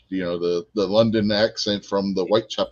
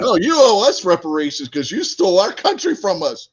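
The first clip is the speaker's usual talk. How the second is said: loud, high, hammed-up protest; playful shouted accusation; mock outrage; 8 semitones higher, 6 dB louder than usual. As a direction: argue back at full volume for the joke. Mock quarrel over redcoats.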